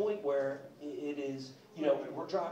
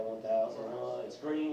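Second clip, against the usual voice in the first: usual speaking voice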